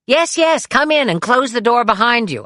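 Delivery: impatient shout to the door. Retold or imitated. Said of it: "I shouted".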